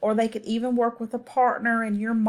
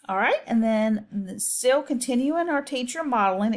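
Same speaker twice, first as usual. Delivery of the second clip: ordinary delivery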